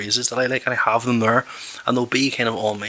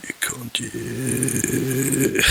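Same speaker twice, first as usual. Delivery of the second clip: hungover groan; much slower than usual. Pretend. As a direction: moan it like waking up hungover. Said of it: "do the whole".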